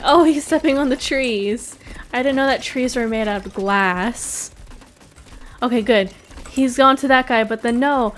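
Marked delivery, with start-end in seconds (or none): none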